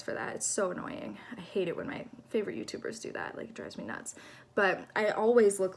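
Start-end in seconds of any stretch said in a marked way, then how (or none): 0.0-4.2 whispering. Real, not acted. Embarrassed aside about her habit.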